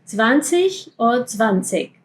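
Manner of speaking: slow zwanzig first with K then with ch; two ways of zwanzig. Shown as a demonstration. Teacher contrasting both zwanzig endings. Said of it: "Ambos están bien".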